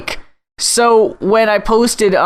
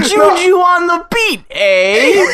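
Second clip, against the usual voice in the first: mock hip dad; 10 semitones higher, slower than usual. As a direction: play a clueless dad joining in. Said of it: "executive impression continues".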